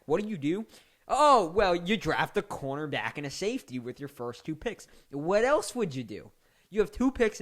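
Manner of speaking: loud mocking rhetorical questions; sarcastic mockery. Real, not acted. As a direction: sneer at the choice as if it's obvious. Sarcastic mock of Bears picks.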